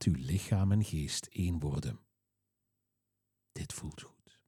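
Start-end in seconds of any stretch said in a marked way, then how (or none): 3.5-4.4 breathy hushed whisper. Acted. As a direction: whisper it close and gentle. Whispered within relaxation script.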